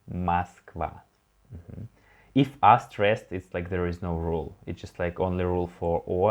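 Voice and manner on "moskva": Moskva with the first O said as A, MASK-VA; unstressed O read as A. Shown as a demonstration. Teacher repeats Moskva to show reduced O, clear and deliberate. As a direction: model Moskva, the O softened to A. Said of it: "so we read it like A".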